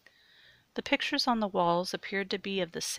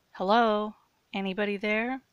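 raised questioning call; tentative calling out; 3 semitones higher, 5 dB louder than usual. A narrator's reading. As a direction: call out to see if anyone is there. Calling into a dark basement.

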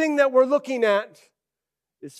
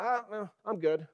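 casual Jesus; 10 dB quieter than usual. Retold or imitated. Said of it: "Jesus like".

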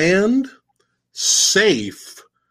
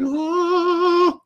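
high, airy swooning oh; martyr in ecstasy; 13 semitones higher than usual. Retold or imitated. Lucy mid-martyrdom with eyes rolled, high swooning oh. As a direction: swoon upward like a martyr seeing heaven. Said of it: "She's like".

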